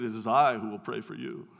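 put-on low, deep voice in a thick African accent. Visiting pastor from Sierra Leone refusing his prayers, deep African accent. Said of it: "really deep voice and this thick African accent".

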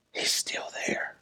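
hushed whispered repeat. Real, not acted. Preacher whispering to the congregation, hushed whisper. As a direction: drop it to a breathless whisper. Whispered echo after shout.

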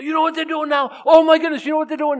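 high, loud imitation of a panicking mom; mock freak-out. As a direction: squeal it in exaggerated alarm. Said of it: "And she's freaking out".

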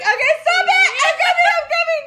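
flustered mom shouting to her son through the door; laughing shout. Retold or imitated. Frantic mom answering son.

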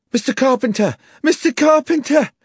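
very high, loud frantic cry; screaming. A narrator's reading. Young woman calling the landlord into the bar, screaming. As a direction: scream for help as you burst in. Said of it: "came screaming into the bar".

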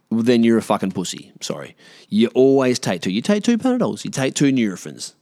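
ordinary delivery

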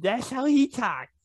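high, mushy protest; mouth full of tongues. Real, not acted. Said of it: "You sound like your mouth's just full of tongues".

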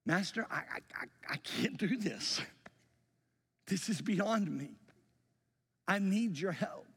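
a hint of a desperate prayer, stammering at the start; desperate plea to Jesus. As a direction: plead as if you're going under. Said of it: "and saying".

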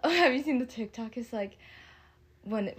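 normal talking voice

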